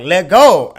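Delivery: loud barked order, imitating the instructor; loud mock command to let go; instructor yelling. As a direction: yell it over the wind. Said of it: "he was like".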